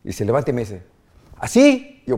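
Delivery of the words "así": high, loud, one-word question; retold eager shout. Boy calling to dad after a tackle, eager. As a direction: call it out like a kid seeking approval.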